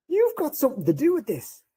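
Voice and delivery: high-pitched mock dad accusation; imitating dad. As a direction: play his dad catching him out, high. Dad accusing in retold scene.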